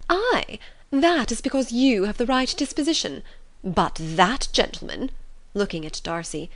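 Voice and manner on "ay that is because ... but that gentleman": high, emphatic mother voice; indignant fussy mother. Acted. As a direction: make a pointed dig at a guest. Mrs Bennet needles Darcy.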